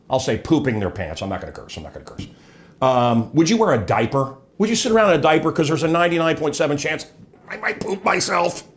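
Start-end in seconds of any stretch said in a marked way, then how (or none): 1.2-2.3 low, very soft, fading away; dropping to a barely audible aside; self-restraining mutter. Real, not acted. Reminding himself not to curse. 7.5-8.6 higher put-on fretful voice; hammed-up fretting; mock worrier. Mocks a fearful diaper wearer.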